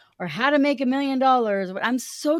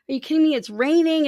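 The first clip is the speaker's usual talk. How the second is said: louder, higher mock exasperation; upset; 6 semitones higher, 5 dB louder, faster than usual. Speaker voicing a mother upset about prom plans, exasperated. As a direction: protest as an upset mother, pitched up. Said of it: "she was upset".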